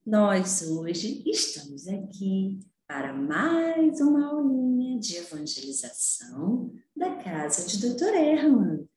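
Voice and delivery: ordinary talk, nothing marked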